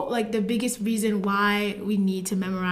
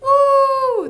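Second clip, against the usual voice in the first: cheering; 14 semitones higher, 6 dB louder than usual. Real, not acted. Cheering the reveal.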